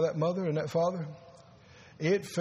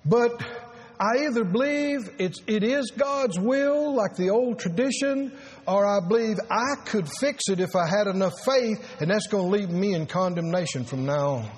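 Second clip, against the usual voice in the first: full-force preaching; 4 semitones higher than usual. Acted. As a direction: hammer the point home to the congregation.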